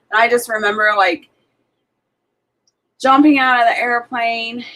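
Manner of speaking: ordinary talk, nothing marked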